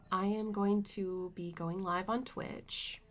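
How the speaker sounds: usual speaking voice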